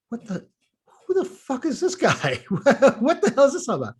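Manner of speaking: high, baffled disbelief with a laugh; incredulous. Retold or imitated. To the rude stranger, baffled laughing disbelief. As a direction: react like a stranger just ambushed you. Inner reaction to stranger's rebuke.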